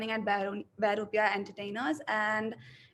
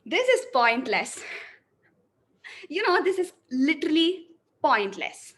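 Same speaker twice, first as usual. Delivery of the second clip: louder, higher, emphatic delivery; energetic, forceful reading; 5 semitones higher, 5 dB louder than usual. Shown as a demonstration. Acting student reads the second version, louder and higher. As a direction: push every line out with energy. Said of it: "So I'll go with the second one".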